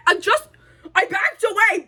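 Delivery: high, squawked mock wail; comic outburst. Vlogger backing off after kissing viewers, comic high outburst. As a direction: blurt it out in a silly high cry.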